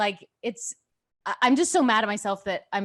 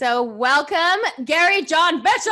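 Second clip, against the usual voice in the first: announcer welcome; 8 semitones higher, 9 dB louder than usual. Acted. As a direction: hype up the guest's name like a game-show host. Host introduces guest.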